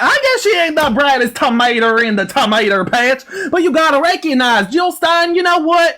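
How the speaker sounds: high, twangy yokel put-on; hillbilly interrogator. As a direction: grill them like an exasperated country bumpkin. Mock hillbilly host argues.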